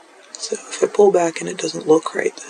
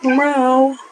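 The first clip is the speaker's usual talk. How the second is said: meow; 9 semitones higher, 5 dB louder than usual. Real, not acted. Talking to her cat.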